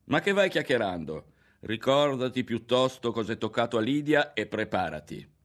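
jeer, then warn him; taunting threat. A narrator's reading. Berto taunts and threatens Ario.

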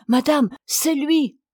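the maid calling out that the hairdresser has arrived, excited; maid's cry. Acted. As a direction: call out the good news, relieved. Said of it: "la femme de chambre s'écrie".

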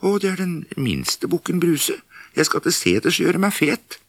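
very high, small voice; a little goat; tiny goat voice. Acted. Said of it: "Den var så fin i målet".

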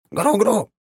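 very high squeaky call, proud; a tiny sprite pleased with itself; proud creature chirp. A narrator's reading. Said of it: "гордо выпрямив спину". (гордый писк маленького древня)

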